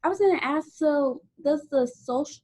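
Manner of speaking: talking with a retainer in; words slightly blurred by a retainer; retainer in mouth. Real, not acted. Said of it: "sorry, I have my retainer in".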